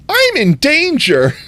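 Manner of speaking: sudden high, loud mock panic; I'm in danger. Going into a cave in the game, yelping in mock panic. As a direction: squeal it like a meme in mock alarm. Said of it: "I switched from sultry ... to".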